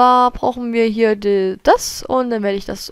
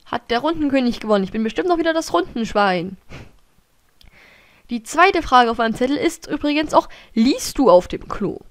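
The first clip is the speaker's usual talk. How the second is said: ordinary talk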